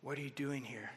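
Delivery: soft, low murmured question; a hushed divine question; God's whisper. Pretend. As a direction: ask it gently, barely above a breath. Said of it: "God might whisper and say".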